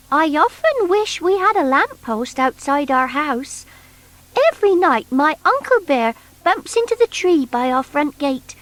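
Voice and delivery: put-on high, sweet girlish voice; Tessie Bear's voice. Tessie telling Noddy about Uncle Bear, high girlish voice. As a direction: lift it up as little Tessie.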